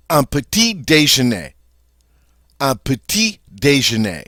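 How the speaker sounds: "petit déjeuner" as puh-TEE day-zhuh-NAY; French pronunciation. Shown as a demonstration. Teacher modelling "Un petit déjeuner" twice. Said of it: "un petit déjeuner is breakfast".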